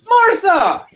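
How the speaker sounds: high, loud cry of a name; overjoyed shout across the room; joyful call. Acted. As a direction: call out to a long-lost friend. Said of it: "Deacon runs in and goes".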